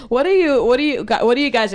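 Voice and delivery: trying to ask through giggles; real laughter spilling into the question; laughing. Real, not acted.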